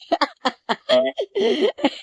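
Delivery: a burst of high, mocking laughter. Real, not acted. Vlogger laughing at Pitara's raw koftas, high mocking laugh.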